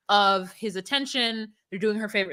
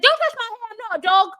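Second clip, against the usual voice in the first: high, loud whiny mock of her; 12 semitones higher, 6 dB louder, faster than usual. Friend mocking the girlfriend, high whiny squeal. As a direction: squeal it like a spoiled woman. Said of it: "and she's like".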